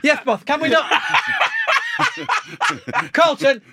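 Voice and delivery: high, loud shouted orders; mock shouting. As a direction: shriek the orders like an angry manager. Repeated clip mimicry, shrill.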